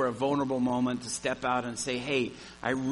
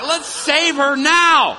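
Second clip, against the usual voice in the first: urgent shout; 13 semitones higher, 14 dB louder, much slower than usual. Retold or imitated. Panicked rush to rescue her.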